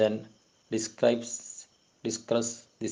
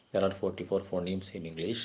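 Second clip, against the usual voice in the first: ordinary talk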